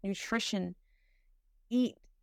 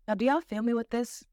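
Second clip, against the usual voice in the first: normal talking voice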